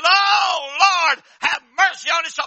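loud, high, shouted lament; grieving mother's wail. Acted. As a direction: wail over the casket. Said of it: "his mother fell across his casket and said".

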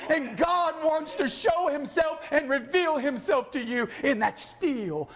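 building exhortation. Real, not acted. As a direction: urge the congregation with mounting fervor. Builds exhortation at full force.

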